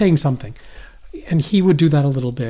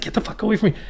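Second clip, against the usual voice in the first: high-pitched mock alarm; retold alarmed recoil; 8 semitones higher, much faster than usual. Dreamer driving off the creepy kid, high mock alarm. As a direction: push them away in comic horror.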